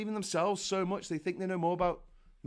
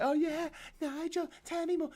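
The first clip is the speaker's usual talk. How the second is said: put-on starry-eyed admirer, pitched up; mock adoring fan; 9 semitones higher than usual. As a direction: gush like a besotted follower, sarcastically. Mocking Nigel's adoring followers.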